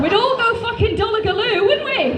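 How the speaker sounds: high, loud laughing rant; cackling mock indignation; mock outrage. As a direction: shriek it in gleeful disbelief. Mocking the mangled place name.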